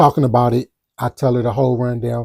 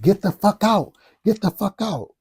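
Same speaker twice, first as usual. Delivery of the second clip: imitating her, pitched much higher; furious girlfriend; 8 semitones higher than usual. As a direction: play the enraged girlfriend throwing him out. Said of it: "She's like".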